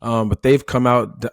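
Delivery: usual speaking voice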